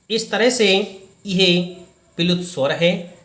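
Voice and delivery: ordinary talk, nothing marked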